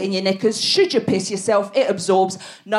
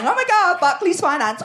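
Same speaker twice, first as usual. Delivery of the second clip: higher hearty shout; mock bankers cheering; 9 semitones higher than usual. Finance team cheering at Tough Mudder, hearty whoop.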